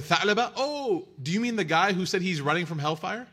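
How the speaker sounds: sudden realisation, rising into a question; surprised recognition. Acted. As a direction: act out someone suddenly placing a name, pitch jumping up. Shepherds recognise the man asked about.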